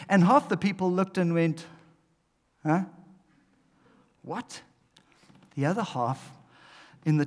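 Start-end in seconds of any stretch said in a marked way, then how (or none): 2.6-4.6 soft, puzzled crowd reaction; mock bafflement. Half the people looking at Jesus, quietly baffled. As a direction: imitate quiet, blank confusion.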